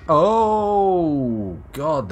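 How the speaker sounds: sudden loud high gasp; a near miss, a loud startled oh. Real, not acted. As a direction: jolt out a big startled oh.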